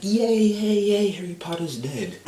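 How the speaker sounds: high, loud crowd cheer; mock cheering. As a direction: cheer like a whole crowd, hammed-up. Crowd cheers at Harry's death.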